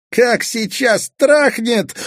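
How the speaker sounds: put-on high, slow boyish exclaim; boy awed by the thunder; thrilled boy. Kazik thrilled at storm. (мальчик в восторге от грозы)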